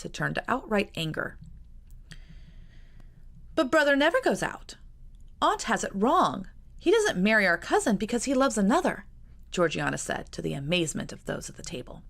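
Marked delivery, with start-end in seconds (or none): 3.5-9.0 put-on high, eager girlish voice of a younger sister. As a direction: play the earnest little sister, pitched up. Georgiana voiced by narrator.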